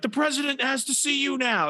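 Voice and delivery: high-pitched mock-urgent summons; video game character.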